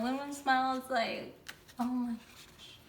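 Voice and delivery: ordinary talk, nothing marked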